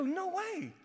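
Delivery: high, softened mock astonishment; comic high-pitched refusal to believe; stunned disbelief. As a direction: say it high and small, incredulous. Retelling refusal of zombie run.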